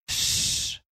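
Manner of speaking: soft, drawn-out shushing sound; soft hushing. A narrator's reading. Werewolf comforts the crying girl, soft shush. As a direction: hush someone gently.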